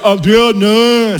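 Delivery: slow, drawn-out call of her name; imitating a beaten Rocky. Preacher imitating Rocky calling his wife after a beating, slow and drawn out. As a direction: call out like a battered boxer. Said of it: "decía".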